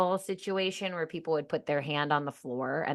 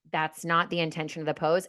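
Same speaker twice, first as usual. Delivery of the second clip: ordinary talk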